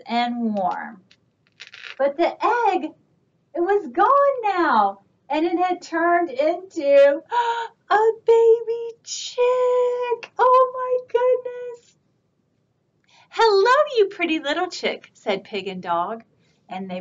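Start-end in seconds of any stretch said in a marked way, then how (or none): 2.0-12.0 high and wondering, rising to a gasp; amazed discovery. A narrator's reading. Egg hatches, reader gasps. 13.3-15.1 put-on high, fast cooing to a baby chick; cooing greeting. Pig and Dog greet chick.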